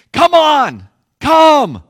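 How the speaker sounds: high, shouted summons; big beckoning holler; calling out loudly. Shown as a demonstration. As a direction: yell it across the stormy water.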